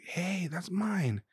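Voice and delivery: put-on high, slow, soft kid's protest; lightly voiced five-year-old; imitating a kid. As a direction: mimic a little boy claiming his ball. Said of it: "the kid was like".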